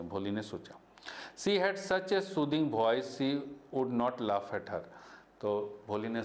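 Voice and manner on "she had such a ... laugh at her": slow, deliberate read-aloud; storybook narration for students; story reading. A narrator's reading. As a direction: read the textbook line slowly to the class. Teacher reads story text aloud.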